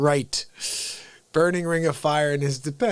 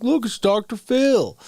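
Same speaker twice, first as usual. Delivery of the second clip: high, louder Doctor Phil voice; Doctor Phil impression; 8 semitones higher, 4 dB louder than usual. Recalling his Doctor Phil clip, high and loud. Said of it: "he's like".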